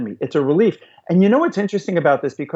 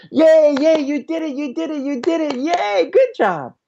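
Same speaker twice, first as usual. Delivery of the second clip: excited toddlerese; 11 semitones higher than usual. Shown as a demonstration. Parent cheering a child who did it, high and excited. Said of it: "we automatically speak toddlerese".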